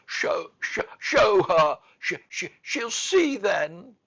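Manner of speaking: high, loud, stammering sobs; gasped between sobs. A narrator's reading. Sobbing boy telling nurse to show Mary, gasping. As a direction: catch on words, then burst out. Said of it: "gasped out between two sobs".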